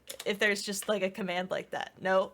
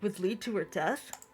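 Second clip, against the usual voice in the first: story narration. Acted. Reads game narration aloud.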